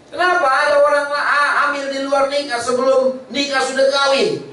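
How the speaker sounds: high, ringing preacher's questions; fiery pulpit call-and-answer, pitched high; preaching at full force. Acted. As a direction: lift the whole congregation with each question.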